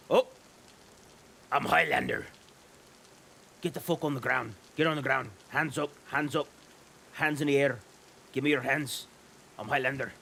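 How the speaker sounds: a lightly done tough guard barking orders; a hint of a gruff soldier voice; mock soldier shouting commands; bad Highlander voice. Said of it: "that was a very bad Highlander accent".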